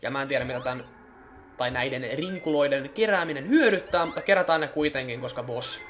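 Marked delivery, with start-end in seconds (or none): none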